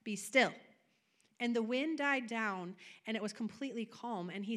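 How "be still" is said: commanding rebuke. Acted. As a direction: shout down the storm, then settle it. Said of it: "rebuked the wind and the waves".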